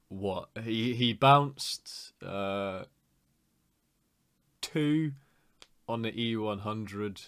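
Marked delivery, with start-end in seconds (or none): none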